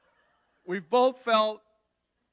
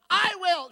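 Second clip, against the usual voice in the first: I will sung much higher and louder; emphatic I will; 13 semitones higher, 12 dB louder than usual. Retold or imitated. Mother throws her hand and belts I will at the deathbed. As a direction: belt out I will with charismatic emphasis. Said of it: "She's emphasizing".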